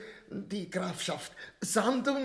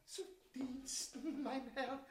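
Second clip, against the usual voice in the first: servile scribe; 4 semitones higher, 8 dB quieter than usual. Acted.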